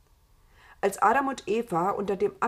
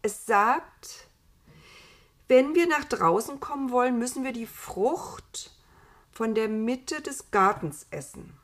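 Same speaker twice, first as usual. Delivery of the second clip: lightly voiced Eva, higher; Eva relaying news; 5 semitones higher, 5 dB louder than usual. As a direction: relay it with weighty pauses in a raised voice. (Eva, höher und mit Pausen)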